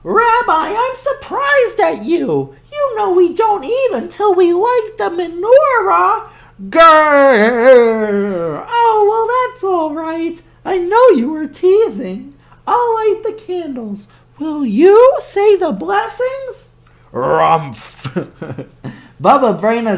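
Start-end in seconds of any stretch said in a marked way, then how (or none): 0.0-6.3 put-on high, sweet old woman; cheerful high granny voice; old grandmother voice. Baba Brena voice. 6.7-8.6 loud rolling growl; loud playful bear growl. Acted. 8.7-16.7 put-on high, sweet old woman; cheerful high granny voice; old grandmother voice. Baba Brena voice. 17.1-18.1 low snorting rumph; gruff bear snort; bear grumble. Acted.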